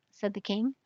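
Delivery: ordinary delivery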